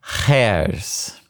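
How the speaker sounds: Khers opened with a raspy throat-clearing kh. Shown as a demonstration. Student showing the throat sound, Khers with a rasped kh. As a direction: scrape the start of Khers as if clearing your throat. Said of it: "when you clear your throat".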